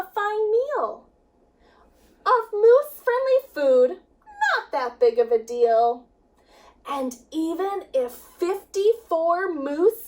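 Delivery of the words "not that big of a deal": ordinary delivery, nothing marked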